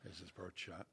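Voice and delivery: soft, hushed golf commentary voice; hushed golf announcer. Shown as a demonstration. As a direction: whisper it like a golf broadcast. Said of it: "like a golf announcer".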